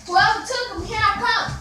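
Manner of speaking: loud warning to the culprit; angry yelling. Real, not acted. Threatening unseen snack thief.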